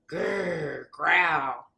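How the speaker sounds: low growled grr from a dog. A narrator's reading. Dog growling at the man voting Brown, low growl. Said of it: "says the dog".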